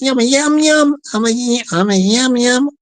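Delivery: mock the Yam Yam twang; Yam Yam accent. Shown as a demonstration. Imitating a touchy Yam Yam from outside Birmingham, Yam Yam accent. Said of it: "Apologies to Brummies and Yam Yams".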